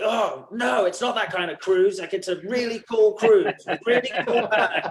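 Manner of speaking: a stranger is told it's a cool cruise, protesting; eager defence. Retold or imitated.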